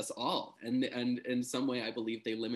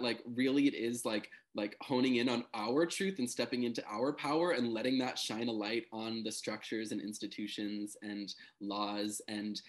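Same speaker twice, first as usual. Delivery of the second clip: normal talking voice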